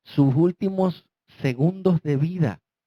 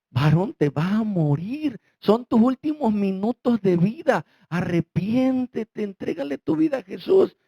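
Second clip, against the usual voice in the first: urgent pleading; 7 semitones higher than usual. Retold or imitated. Pastor pleads with dying man.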